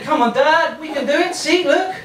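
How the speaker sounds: put-on high-pitched, eager child urging Dad on; enthusiastic youngster. Younger boiling water inspiring its dad to start again, enthusiastic youngster. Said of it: "enthusiasm of the younger boiling water".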